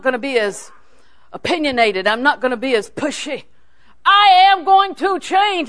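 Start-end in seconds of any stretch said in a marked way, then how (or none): 4.1-5.7 loud, high, slow declaration; emphatic resolve. Retold or imitated. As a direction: declare it word by word at full voice. Declaring her resolve to change.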